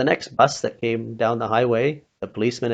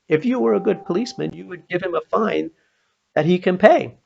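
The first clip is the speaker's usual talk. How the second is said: retold scolding, higher, ending louder; scolding the policeman; 8 semitones higher, 4 dB louder than usual. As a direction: lecture him, climbing in pitch. [name] lecturing the policeman.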